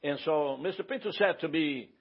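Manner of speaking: usual speaking voice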